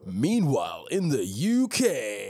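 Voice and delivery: drawn-out, swooping segment intro; grand, stretched-out headline call; mock announcer. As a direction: announce it loudly with big pitch swoops. Theatrical segment change to UK news.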